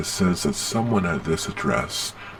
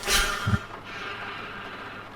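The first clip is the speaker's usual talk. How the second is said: a quiet laugh let out through the nose; soft huffed laugh; 9 dB quieter than usual. Real, not acted. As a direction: chuckle softly, half to yourself. Agent laughs at host's reaction.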